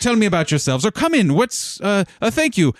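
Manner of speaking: high, flustered, rapid-fire hospitality; excited welcome. Acted. Administrator trying to organize everything while welcoming the guild, flustered excitement. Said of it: "while excitedly welcoming".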